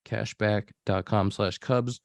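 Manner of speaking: slow, careful sponsor read; measured podcast ad voice. Acted. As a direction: read it like a radio spot, deliberate.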